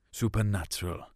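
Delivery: plain reading voice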